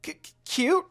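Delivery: high, loud stuttering shock; flustered stammer. Acted. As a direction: stammer it out, caught off guard. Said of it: "blushed heavily in embarrassment".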